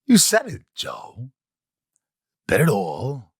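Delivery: lightly voiced Walken line; Christopher Walken. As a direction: mimic Walken's up-and-down delivery. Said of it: "And he goes".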